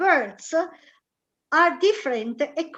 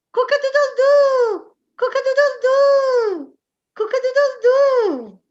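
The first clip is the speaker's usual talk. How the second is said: high, loud crowing cock-a-doodle-doo; playful full rooster call; 10 semitones higher, 7 dB louder than usual. Shown as a demonstration. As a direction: crow it out like a proud rooster. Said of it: "but in English it's".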